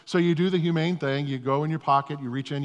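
normal talking voice

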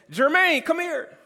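high-pitched, loud call by full name; serious wife calling. Retold or imitated. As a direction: imitate a wife summoning her husband in earnest. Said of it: "that's serious when she says".

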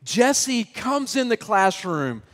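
ordinary delivery

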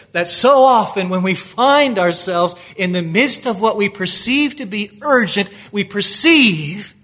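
builds from firm to loud, high urgent preaching; rising preaching fervor. Acted. Preacher voicing Jairus's panic to the congregation, building to loud urgency. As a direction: preach it harder and harder, pleading. Sermon building to full force.

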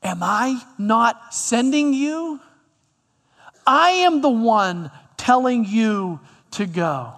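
slow, pressing each word of the challenge; God insisting, face to face; measured, firm command; emphatic God. Acted. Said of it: "God toe to toe, face to face with Gideon saying".

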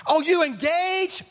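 high, slow, gushing squeal; imitating an excited woman; gushing ladies. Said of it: "you know how the ladies are?".

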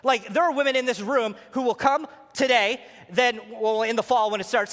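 usual speaking voice

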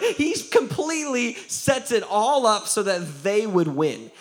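ordinary talk

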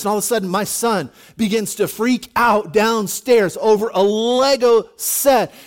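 ordinary talk

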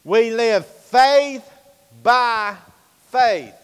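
each word spaced and loud, high; slow, punched, loud refrain on "faith"; slow emphatic refrain. Real, not acted. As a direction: hammer home faith, by, faith slowly.

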